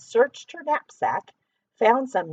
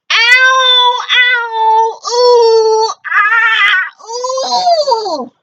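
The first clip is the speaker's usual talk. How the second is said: very high, loud pained howls; loud monster groans of pain, building; even louder monster cries; 11 semitones higher, 13 dB louder, much slower than usual. Acted. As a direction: wail louder than before like a hurting beast. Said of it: "Even louder".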